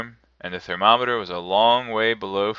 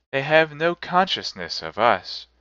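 lightly raised solemn spirit voice; ghost voice; 6 semitones higher than usual. A narrator's reading. Spirit answers Scrooge, lightly voiced ghost. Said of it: "said the ghost".

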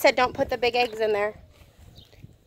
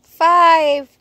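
loud countdown; 5 semitones higher, 5 dB louder, much slower than usual. Real, not acted. Mom counts down the start.

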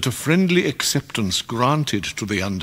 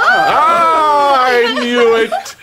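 loud, high, delighted laughing cry; laughing shout; 8 dB louder, much slower than usual. Acted. Micawber crows with laughter at guessing the toast, loud laughing shout. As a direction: burst out laughing in triumph. Host guesses the sweetheart's name.

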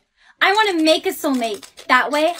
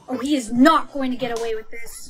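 ordinary talk, nothing marked

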